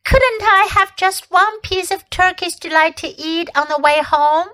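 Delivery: put-on high, wheedling child's request; pleading boy. A narrator's reading. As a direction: beg like a greedy schoolboy. Edmund begging, boy voice.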